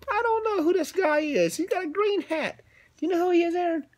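high, soft, puzzled character voice. Acted. A confused sneetch asking Aaron, high and puzzled. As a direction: sound lost and unsure.

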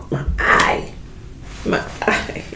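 unvoiced "my eye" whisper; whispered ouch after crab juice; pained whisper. Real, not acted. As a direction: whisper the pain.

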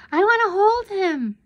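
put-on very high, loud little-girl shout; eager child's piercing demand; announcing child. A narrator's reading. Twin rushing to the cage, announcing. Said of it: "Pammy announced".

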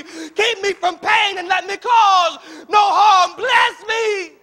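desperate shouted prayer, high and loud; begging God. Acted. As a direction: build to a drawn-out cry to God. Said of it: "He's begging God".

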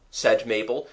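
ordinary delivery

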